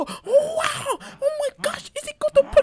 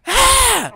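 shrill-high shout much louder; high loud scream; 20 dB louder than usual. Real, not acted. Wrestling his father, a sudden high scream. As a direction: scream out in the struggle.

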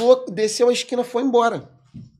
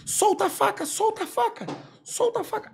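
high, urgent repeated order; repeated command; 14 semitones higher than usual. Retold or imitated. Cop ordering the kneeling kid to drop the knife, high urgent command. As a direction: drill the order again and again.

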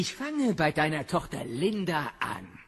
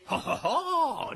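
mocking laugh; 11 semitones higher than usual. Biff mocks wife's threat.

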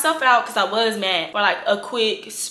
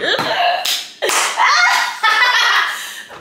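squealing laugh; can't hold the laugh in; high breathless laugh; 12 semitones higher, 4 dB louder than usual. Real, not acted. Laughing at the boyfriend's texts, breathless squeal.

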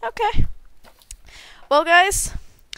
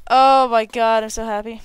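ordinary delivery

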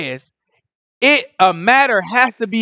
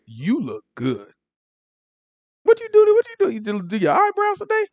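playful teasing; 6 semitones higher, 4 dB quieter than usual. Real, not acted. Teasing woman about makeup.

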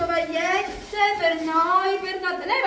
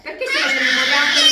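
laughing; 12 dB louder, faster than usual. Real, not acted. Laughing shout at child.

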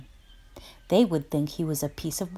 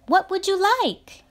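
much higher, fast, eager call; 14 semitones higher, 6 dB louder, faster than usual. Acted. Chameleon calling to the arriving animals, eager. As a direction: call out to customers. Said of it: "called Chameleon".

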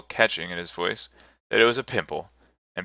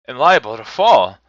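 loud, raised protest; remonstrating; 4 semitones higher, 10 dB louder than usual. A narrator's reading. Scrooge protests to the spirit, loudly remonstrating. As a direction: plead against being led out. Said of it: "Scrooge remonstrated".

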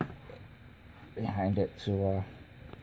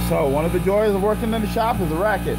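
raised voice over the compressor; loud over shop noise; 6 semitones higher, 5 dB louder than usual. Real, not acted. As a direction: speak up over the racket. Talking over noisy shop compressor.

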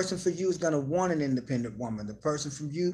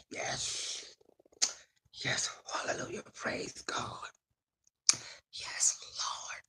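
soft unvoiced praising; whispered praise; 8 dB quieter, much slower than usual. Real, not acted. Host whispering praise to herself. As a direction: breathe out hallelujahs.

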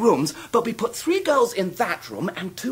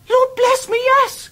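excited; 14 semitones higher, 4 dB louder, slower than usual. Acted. Traddles delighted, confirming marriage.

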